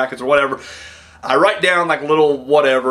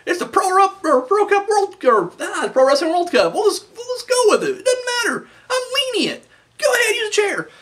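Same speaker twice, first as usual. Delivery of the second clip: a much higher, silly voice for the lax referee; mocking referee. Wrestling fan mocking the lenient referee, high goofy voice. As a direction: play the referee as a breezy pushover, laughing it off. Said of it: "referee's just like".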